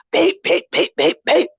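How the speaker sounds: high imitated car horn beeps. Driver imitating the horn honking behind him, high beeps. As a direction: beep like an impatient horn. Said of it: "I hear some beeping".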